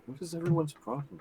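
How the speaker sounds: hushed, quick wondering to oneself; puzzled private mutter; quiet aside. Acted. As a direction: murmur it fast, well under normal volume. Looking around, wondering to herself.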